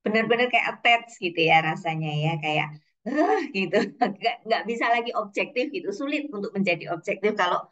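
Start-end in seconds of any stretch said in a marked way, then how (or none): 3.1-3.4 short high-pitched "heh"; acted exasperated gasp. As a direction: let out a mock overwhelmed cry. Acts out emotional overwhelm over child. (seruan gemas bernada tinggi)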